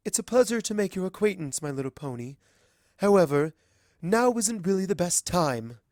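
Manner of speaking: a hint of a gracious queen dismissing someone; polite princess. Celestia to Azalea, politely firm.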